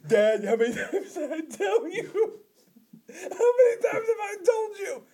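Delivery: hammed-up indignant son shouting at his father; whiny son voice. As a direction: shriek it at dad through laughter. Playing the son, laughing shout.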